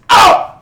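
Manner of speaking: loud sudden pained "Oh!"; pained yelp. Acted. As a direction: cry out as the stone hits. Duke hit by a stone.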